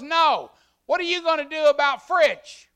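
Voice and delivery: high, loud, pressing wife voice; wife's urgent question. Retold or imitated. Wife pressing the pastor about Fritch, high and loud. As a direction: push him with a high, loud demand. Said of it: "She goes".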